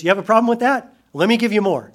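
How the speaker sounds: high, loud dare; provoking. Retold or imitated. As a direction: toss it back at them. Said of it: "he goes".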